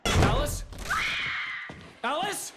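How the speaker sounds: calling out. Acted. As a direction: shout into the dark for her. Husband searches for missing wife.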